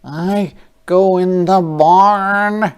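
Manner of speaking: high, loud, slow pig voice; a snug farm pig; storybook pig heading in. Acted.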